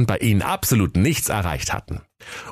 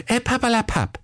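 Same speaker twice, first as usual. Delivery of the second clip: high-pitched princess brush-off; dismissive. Acted. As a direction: wave the objection away in a high voice.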